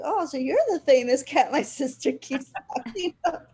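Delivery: amused surprise, laughing as you speak; laughing greeting. Retold or imitated. Meeting the famous cat.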